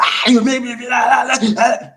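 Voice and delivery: high, loud mocking shout of an angry girlfriend; hammed-up screeching argument; mock hollering girlfriend. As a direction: holler it back like she's fussing at you. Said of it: "don't be arguing, fussing, hollering".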